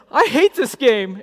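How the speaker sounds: put-on high, loud, slow wail of a tearful child; upset little girl. Little daughter losing the race to dad, tearful outburst. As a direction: voice a small girl about to cry. Daughter loses, starts crying.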